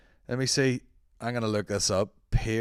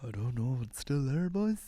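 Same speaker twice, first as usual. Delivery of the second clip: softer put-on Irish accent; mimicked Irish accent; 5 dB quieter than usual. Host parroting the actor's line, teasing Irish accent. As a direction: echo it back in a mock brogue. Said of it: "in and out of accents".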